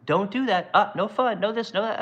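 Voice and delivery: lightly voiced high nagging car; a hint of a prissy scold; mock nanny car. Mocking his Tesla's nagging warnings, high and whiny. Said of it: "constantly pinging me like".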